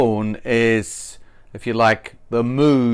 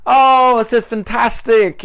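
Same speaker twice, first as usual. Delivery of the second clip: much higher, louder delight; very excited; 9 semitones higher, 5 dB louder than usual. Shown as a demonstration. Teacher demos an animated enthusiastic speaker. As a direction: gush with hands waving. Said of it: "their hands are waving around".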